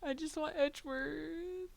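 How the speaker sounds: playfully pine like a heartbroken fan; put-on lovesick whine; mock pining.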